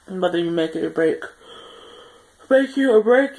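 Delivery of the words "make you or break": words stretched through a yawn. Real, not acted. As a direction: yawn while saying it. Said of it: "Sorry for yawning".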